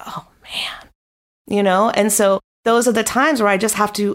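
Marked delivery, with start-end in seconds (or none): 0.1-0.9 whispered, drained groan of reluctance; weary whisper. Retold or imitated. Dreading three podcast interviews, a tired whispered sigh. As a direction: breathe it out like you can't face the day. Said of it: "I'm just like".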